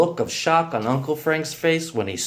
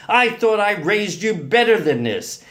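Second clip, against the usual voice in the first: disgusted reproach; 7 semitones higher, 3 dB louder than usual. A narrator's reading. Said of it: "dropped it in disgust".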